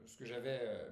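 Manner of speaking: ordinary talk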